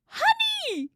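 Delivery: sharp gasp, then high loud cry; excited squeal. Real, not acted. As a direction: squeal it to a newfound kindred spirit. Gasps at finding a kindred viewer.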